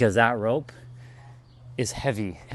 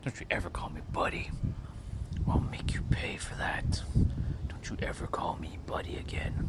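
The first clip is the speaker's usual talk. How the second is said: whisper; 5 semitones lower, 8 dB quieter than usual. Retold or imitated. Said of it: "I'm like".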